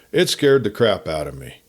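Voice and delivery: ordinary delivery, nothing marked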